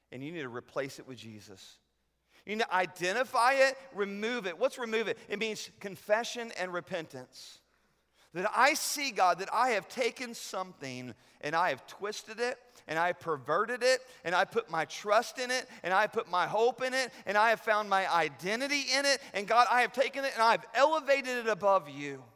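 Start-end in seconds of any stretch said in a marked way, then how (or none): 2.5-4.6 forceful repeat. Shown as a demonstration. 8.3-22.2 voices the confession aloud, much louder and higher; fervent loud prayer. Acted. Preacher leads church in confession, fervent loud prayer.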